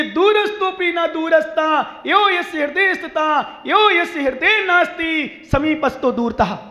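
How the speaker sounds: slow, high Sanskrit verse recitation; shloka recitation. Acted. Preacher quoting a sutra on nearness, slow declaimed recitation. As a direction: declaim the verse in long sustained phrases.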